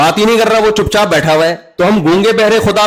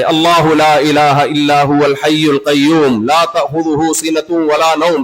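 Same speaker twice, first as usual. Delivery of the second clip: Quranic recitation; 3 semitones lower than usual. Acted. Preacher reciting the verse of God's self-introduction, intoned. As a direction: recite the Arabic verse in a lower tone.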